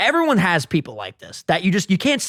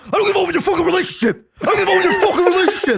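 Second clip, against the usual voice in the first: fast, high-pitched mock outburst; getting hyped up; 13 semitones higher, 3 dB louder, much faster than usual. Mike denying involvement in the relationship, mock hyped up. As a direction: escalate into a frantic imitated protest. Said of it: "And he starts getting hype".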